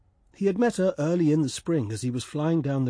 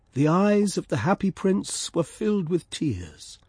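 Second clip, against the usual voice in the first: usual speaking voice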